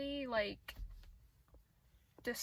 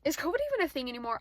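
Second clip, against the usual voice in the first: exasperated rant; 9 dB louder than usual. Real, not acted. Ranting at masked drivers.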